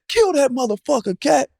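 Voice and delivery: high, yelled egging-on. Retold or imitated. Player cheering a teammate on in a scrimmage, high yell. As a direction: hype him up to score.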